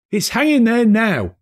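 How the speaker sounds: put-on high, loud boy's reply; boy calling back up; cheeky lad; street boy. Boy voiced.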